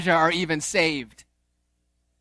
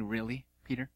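usual speaking voice

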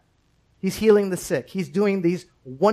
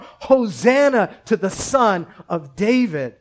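loud, slow, raised cry of praise; 4 semitones higher, 5 dB louder than usual. Acted. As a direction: draw out the children's shout of praise. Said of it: "crying out".

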